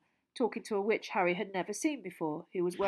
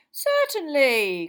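slow, loud, higher hearty cry; Bagman crying out; 7 semitones higher, 13 dB louder, much slower than usual. Acted. Jolly official loudly agreeing to the reporter's request, slow. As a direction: bellow it out gladly. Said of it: "cried Bagman".

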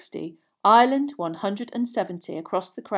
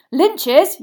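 high, loud quick correction; yelling; 7 semitones higher, 9 dB louder than usual. A narrator's reading. Boy corrects his friends mid-dive, yelling. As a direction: yell over your friends. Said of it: "yelled Harry".

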